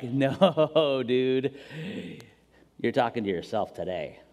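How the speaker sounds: deflate the preacher, low and amused; lightly voiced James teasing. James correcting the preacher, lightly voiced, low and teasing. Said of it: "And James is like".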